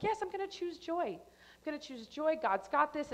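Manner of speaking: high, sing-song self-encouragement; mock-cheerful resolve. Driver telling herself to choose joy, mock-cheerful resolve. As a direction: chirp it like a resolution about to fail.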